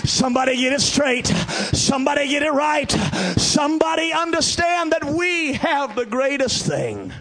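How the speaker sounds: loud high shouted preaching; fiery preaching. Real, not acted. Preacher shouting to the church, fervent. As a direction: drive it home to the congregation.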